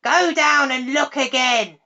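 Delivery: crying out scornfully. A narrator's reading. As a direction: scold the lazy toad loudly. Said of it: "The witch cried".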